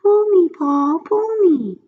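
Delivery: high, loud tempting little voice; pigtail voice. A narrator's reading. The pigtail coaxes Paul in a high little voice. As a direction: coax him like a tiny tempter. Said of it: "a little voice coming from the pigtail".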